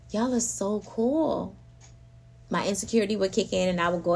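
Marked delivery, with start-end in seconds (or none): 0.1-1.5 awed admiration. Retold or imitated. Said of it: "I was just like".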